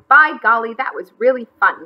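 loud, cheerful burst, lightly winded; happy wheeze. A narrator's reading. McGreely pleased after kite running, happy wheeze. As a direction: exclaim it happily after a run. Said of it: "wheezed happily".

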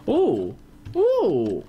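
excited gasp. Real, not acted. Finds treasure chart.

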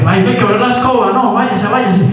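high, exasperated "váyase"; annoyed shooing. Acted. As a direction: shoo the fool away impatiently. Acts the lady shooing the bobo away.

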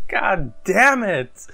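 ordinary talk, nothing marked

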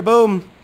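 loud, high cannon boom; imitated cannon fire; mock cannon boom. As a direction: shout the cannon blast. Cannon sound in story.

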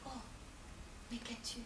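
hushed anxious question; husband whispering his concern to the sulking wife; whispered worry. Acted. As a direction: ask softly in a whisper.

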